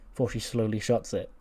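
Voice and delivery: usual speaking voice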